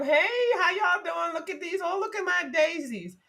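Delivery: mock the commercial's Mother Nature, sugary and high; imitating Mother Nature. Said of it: "She waltzing through the daggone forest".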